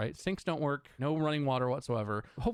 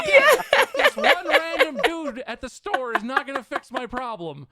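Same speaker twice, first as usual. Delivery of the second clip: loud, high laughing rant; laughing fury; 8 semitones higher, 9 dB louder, slower than usual. Real, not acted. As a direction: rant through laughter, half furious.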